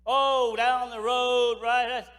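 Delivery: loud, slow, careless drawl; mock an agent shrugging it off; lazy complacent answer.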